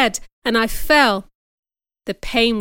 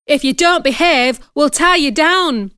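high, loud threat; threatening; 5 semitones higher, 4 dB louder than usual. Acted. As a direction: warn her sharply. Maid threatening Jane.

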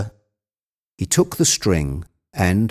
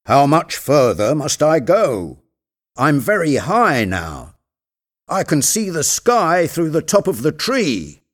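raised pitch, calling down from above; climber Jupiter; 6 semitones higher than usual. Acted. Jupiter calling down to Legrand from the treetop, raised voice. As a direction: call down from high in a tree.

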